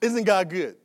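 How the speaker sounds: usual speaking voice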